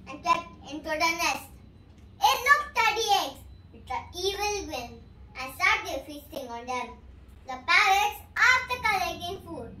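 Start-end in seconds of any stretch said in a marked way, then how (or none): none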